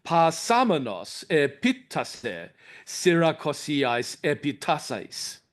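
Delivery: slow, open broad alphas in Doric Greek; Doric Greek recitation. Shown as a demonstration. Lecturer asking the room to listen, reciting the line with broad Doric alphas. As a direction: savour each ah sound, slowly. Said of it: "Now listen to the second line".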